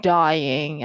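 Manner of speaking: usual speaking voice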